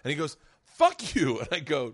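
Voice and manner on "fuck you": louder, higher mock retort; retold angry outburst. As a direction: act out an offended man's comeback. Heckled man snaps in story.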